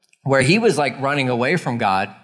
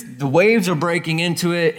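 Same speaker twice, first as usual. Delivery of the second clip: ordinary delivery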